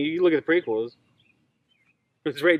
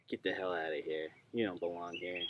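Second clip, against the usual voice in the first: much lower, soft grumbling voice; mock dismissive fans; 9 semitones lower, 15 dB quieter than usual. Fans shutting down a Jango opinion, low and grumbly. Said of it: "everybody's like".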